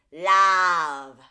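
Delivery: "Love" said loud and nasal as "lav" with a wide flat vowel; splatted nasal vowel. Shown as a demonstration. Coach imitates nasal singers saying "Love" as "lav". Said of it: "like it's a L A V".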